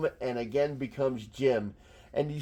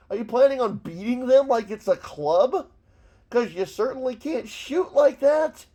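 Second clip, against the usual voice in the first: high-pitched, incredulous scolding; worked-up, high-pitched comic rant; exasperated rant; 8 semitones higher, 5 dB louder than usual. Real, not acted. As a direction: berate someone for a stupid mistake. Rants at drawn rifle grip.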